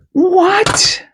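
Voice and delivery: high, loud, cracking cry; can't believe your eyes; real cry of amazement. Real, not acted. Finds Indian head penny.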